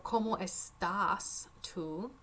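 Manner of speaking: cómo estás tú, slow and clear; slow reading. Shown as a demonstration. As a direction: read it slowly to the class. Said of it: "Número cuatro".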